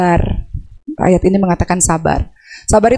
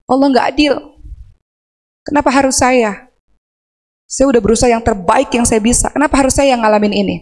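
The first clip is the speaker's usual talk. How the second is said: imitating angry complaint; 3 semitones higher than usual. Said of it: "ada orang yang marah-marah".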